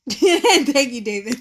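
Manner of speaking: loud, cracked-up thank-you; real laughter through the words; laughing thanks. Real, not acted. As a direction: burst out laughing while saying thanks. Laughing at David's live comment.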